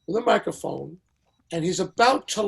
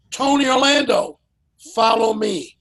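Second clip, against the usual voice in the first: calling out names, higher and louder; 5 semitones higher, 8 dB louder than usual. Retold or imitated. [name] lining up stars on the dais, a loud commanding call. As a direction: summon the stars like the host in charge. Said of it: "he goes".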